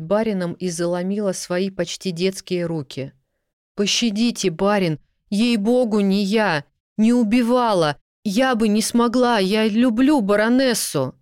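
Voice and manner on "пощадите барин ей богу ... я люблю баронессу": rising frantic plea, louder and higher; desperate pleading. Acted. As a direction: beg for mercy, near tears. Servant begs for her life.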